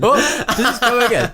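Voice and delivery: high, laughing mock Indian accent. Reader retries for co-host, high Indian accent. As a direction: squeal it in a comic Indian accent. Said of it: "bring some Punjab back into that".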